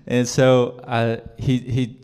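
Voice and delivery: high loud laugh spilling into words. Real, not acted. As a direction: laugh off the crowd's answer. Laugh after audience quip.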